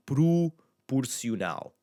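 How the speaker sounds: slow "proporcional" as "proo-poor-syoo-NAL". Shown as a demonstration. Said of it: "that oo sound again".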